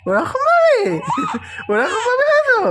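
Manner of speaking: very high, delighted-sounding question; playful amazement. Pretend. Adult reacts to little one showing a laugh, playfully amazed. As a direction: exclaim high, as if astonished by a baby.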